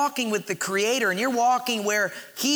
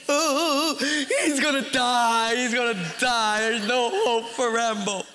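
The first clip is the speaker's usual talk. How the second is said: hammed-up wailing sob; mock hysterical crying. Wife weeping for doomed Rambo, mock hysterical wail. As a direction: imitate a wife blubbering over a film. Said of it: "My wife starts hysterically crying".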